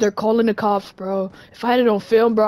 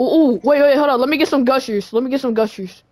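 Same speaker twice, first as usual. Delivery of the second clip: ordinary talk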